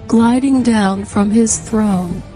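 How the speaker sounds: ordinary delivery, nothing marked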